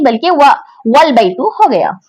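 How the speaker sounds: normal talking voice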